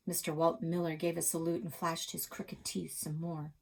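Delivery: ordinary delivery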